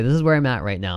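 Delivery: ordinary talk, nothing marked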